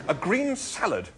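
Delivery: slow, high-pitched insistence; firm. A narrator's reading. Arthur insisting on his order, firm. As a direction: say it slowly, allowing no argument. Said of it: "said Arthur firmly".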